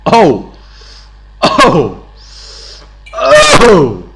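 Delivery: big, loud exclaimed "oh", falling away; loud "oh" yells. Pretend. Gamer crowing over a battle hit, loud yells. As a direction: belt out each "oh" separately.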